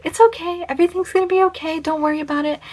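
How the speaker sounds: high, gentle comforting voice; calm him down like a worried child; soothing reassurance. Retold or imitated. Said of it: "tell me why I'm being like".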